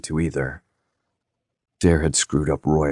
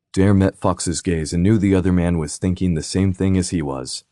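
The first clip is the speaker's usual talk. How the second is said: plain reading voice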